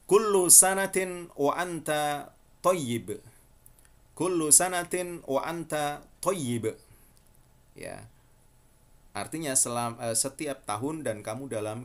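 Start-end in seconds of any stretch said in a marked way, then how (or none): 0.1-6.8 "kullu sanatin wa anta thayyib" slow and high with a doubled "ll"; Fusha pronunciation. Shown as a demonstration. Teacher presenting the formal congratulation slowly.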